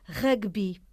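"rugby" as Portuguese râguebi, stressed first syllable; Portuguese pronunciation. Shown as a demonstration. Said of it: "Pronúncia".

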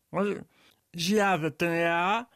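usual speaking voice

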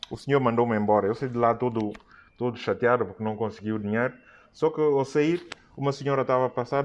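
ordinary delivery

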